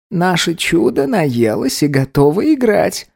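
slow, tender granny voice; grandmother voice. A narrator's reading. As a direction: coo it like a fond grandma. Grandmother brings child.

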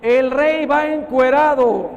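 put-on loud, slow shout of a small boy; a child yelling the truth to the crowd, slow and loud; child's shout. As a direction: shout it out like a kid who can't lie. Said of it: "el niño lo ve y grita".

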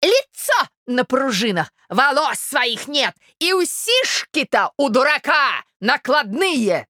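high, slow, forceful jeering; creaking spite. A narrator's reading. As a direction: creak out each insult separately. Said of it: "скрипела Марья Александровна". (злобно скрипеть)

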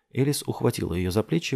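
usual speaking voice

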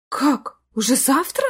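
bewildered mother; flustered surprise. A narrator's reading. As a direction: ask as if caught off guard. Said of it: "растерялась мама".

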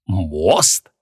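low, rising incredulous question; understated astonishment, tune rising; amazed. Acted. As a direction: echo the word in disbelief. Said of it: "ещё больше изумился Креол".

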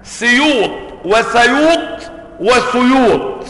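سيوط وسيوط وسيوط as slow, separate variants with i, a, u after s; three vowels on seen. Shown as a demonstration. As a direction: say سيوط وسيوط وسيوط slowly, changing the first vowel each time. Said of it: "بالفتح وبالكسر وبالضم".

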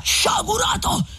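high-pitched cry of rebuke; full, resonant cry. A narrator's reading. Friar rebuking vengeful Renzo, full ringing cry. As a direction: cry out, voice back at full ring. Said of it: "una voce che aveva ripresa tutta l'antica pienezza".

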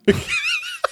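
put-on very high cackling old man laugh; old man cackle. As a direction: cackle like a doddering old grandad.

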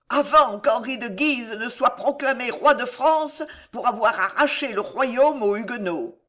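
plain reading voice

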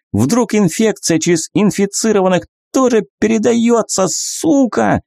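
hysterical, voice breaking at the end; voice cracking. Acted. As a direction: yell until the voice cracks. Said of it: "Голос парня под конец дал петуха".

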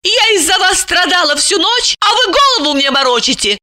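high, enraged woman's voice; furious scolding. A narrator's reading. Lipochka pummels the man who fooled her, furious. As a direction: rail at him in a high voice. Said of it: "Липочка рассвирепела".

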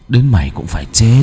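possessed voice rising in menace; gloomy otherworldly voice. Acted. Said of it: "giọng âm u".

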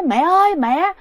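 high pleading calls to mom; dead son's voice calling to his mother; possessed son calling mother. Retold or imitated. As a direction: plead like a lost child. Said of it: "nói".

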